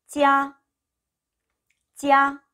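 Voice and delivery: teacher's loud, sustained 찌아; model pronunciation. Shown as a demonstration. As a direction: say 찌아 as one held, even high note. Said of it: "라고 읽으시면 되고요".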